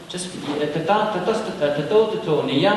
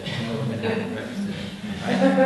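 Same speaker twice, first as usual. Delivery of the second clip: higher, quicker, with a laugh; daydreaming aside; 6 semitones higher, faster than usual. Shown as a demonstration. Teacher mimicking the distracted mind, laughing daydream. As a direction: get carried off by the thought of breakfast, chuckling. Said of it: "Then the mind wanders up".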